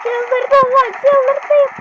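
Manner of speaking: high, loud exasperated cry; losing it in the heat of battle; frantic. Real, not acted. Gamer overwhelmed by enemy attack, high frantic cry.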